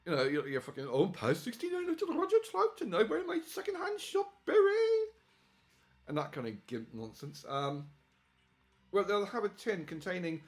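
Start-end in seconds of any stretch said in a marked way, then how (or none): 0.9-5.1 high, hammed-up camp voice; mock camp voice. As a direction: lampoon him, pitched way up. Mocking the seller's chatter.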